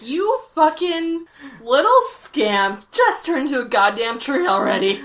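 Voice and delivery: loud mock exasperation, stretched at the start; upset. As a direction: scold the scamp in hammed-up frustration. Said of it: "you can tell he's really upset".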